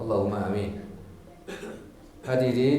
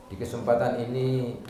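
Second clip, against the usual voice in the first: ordinary talk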